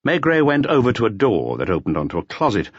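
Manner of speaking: ordinary delivery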